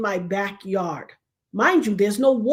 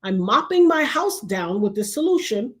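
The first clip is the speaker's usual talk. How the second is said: normal talking voice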